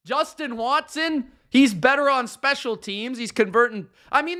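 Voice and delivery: usual speaking voice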